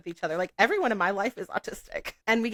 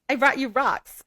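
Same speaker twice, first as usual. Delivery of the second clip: higher and louder, a bright gift-giver's announcement; imitating her friend, higher and louder; friend's excited quote; 7 semitones higher, 6 dB louder than usual. Friend announcing her gift of rocks, higher and louder. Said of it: "And she's like".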